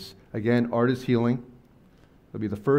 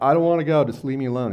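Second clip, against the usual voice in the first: higher, louder pleading refusal; upset refusal; 5 semitones higher, 6 dB louder, faster than usual. Retold or imitated. Veteran refusing to leave his room, upset and loud. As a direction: push back, imitating the vet. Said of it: "He's like".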